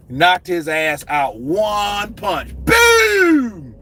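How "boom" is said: shouted boom. Acted. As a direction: punch the word out loud. Acting out the knockout punch.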